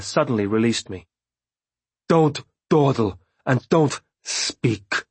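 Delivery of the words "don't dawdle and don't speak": slow, stern command; stern order. A narrator's reading. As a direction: order her sharply, slowly. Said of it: "she ordered me".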